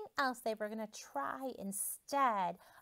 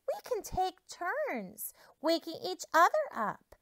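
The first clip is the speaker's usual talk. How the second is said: higher, eager farm animal chorus; chirpy animals; 8 semitones higher, 5 dB louder than usual. Acted. Farm animals proudly pitch their plan, high and eager. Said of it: "the farm animals said".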